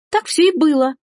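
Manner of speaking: high-pitched, mildly tearful insistence; sobbed protest. Acted. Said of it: "всхлипнула Эжени". (всхлипывая)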